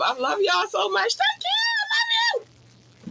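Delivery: overjoyed squealing thank-yous; excited shouting. Real, not acted. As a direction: shout your love to the fans, way up high.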